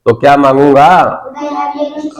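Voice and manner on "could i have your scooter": class chorus. Real, not acted.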